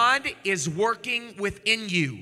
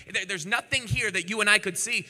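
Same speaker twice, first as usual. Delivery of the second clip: ordinary talk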